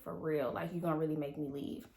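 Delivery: quiet disbelief. Retold or imitated. Disbelief at nurse turning her away.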